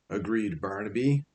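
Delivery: ordinary delivery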